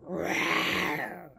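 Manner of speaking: playful growly lion rawr; low, rumbling pretend roar. Voices the lion's answer.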